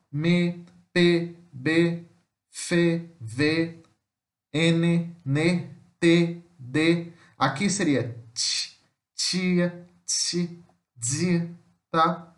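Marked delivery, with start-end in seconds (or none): none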